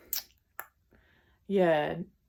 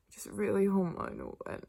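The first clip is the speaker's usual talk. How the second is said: usual speaking voice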